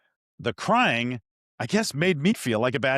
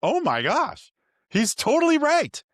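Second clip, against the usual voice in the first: high, louder burst of amazement; stunned realization; 13 semitones higher, 3 dB louder than usual. Retold or imitated. Father realizing his son is right, high amazed outburst. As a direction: hit the sudden insight hard.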